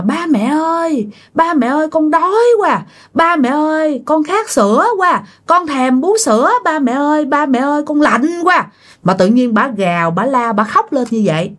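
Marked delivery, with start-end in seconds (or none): 0.0-8.7 put-on high, crying child's wail for mom and dad; wailing possessed baby voice. Possessed woman wailing to dead child's parents, high crying. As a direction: wail like a hungry baby spirit. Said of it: "Bà khóc ré lên và bà gào lên".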